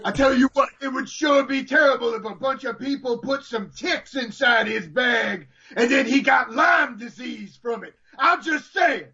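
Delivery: high-pitched ranting wrestling commentator; Booker T. Acted. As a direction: build a hammed-up rant to the catchphrase. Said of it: "your Booker T morphs slightly into Ahmed Johnson".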